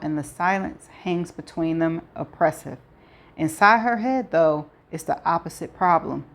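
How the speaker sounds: usual speaking voice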